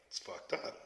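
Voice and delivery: breathy whispered aside; whispered, then said aloud. Real, not acted. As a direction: drop to a whisper on the first take. Repeated remark, first whispered.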